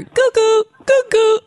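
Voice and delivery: high cuckoo bird call. Pretend. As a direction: chirp it like a cuckoo clock. Sound effect repeated.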